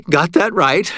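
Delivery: plain reading voice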